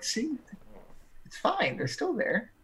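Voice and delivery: high, soft reassuring voice; a soft, high voice telling Sylvie it's fine; high character voice. Acted. Character reassuring Sylvie that the carriage is still there, high and soft.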